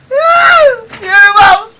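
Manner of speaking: loud, high shout across the rain to mama; calling out. Real, not acted. Daughter shouting to mama from the rain, loud call. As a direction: yell it out so mama hears over the storm.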